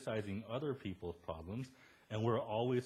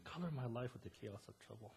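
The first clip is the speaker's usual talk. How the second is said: soft, hushed mock-profound read; a hint of fortune-cookie wisdom, much softer; 12 dB quieter than usual. Preacher mocking a fortune cookie line, softly hushed. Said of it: "like you go to a fortune cookie".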